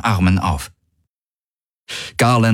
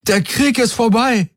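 high, jubilant proclamation; calling out. A narrator's reading. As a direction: shout the news to the troops. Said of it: "rief er".

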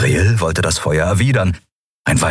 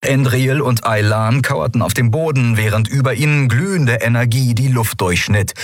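usual speaking voice

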